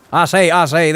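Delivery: loud Southern cartoon rooster catchphrase; Foghorn Leghorn. Streamer shows chat which Southern accent he means, loud cartoon-rooster impression. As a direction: punch it out loud, Southern and blustery. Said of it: "Foghorn Leghorn accent".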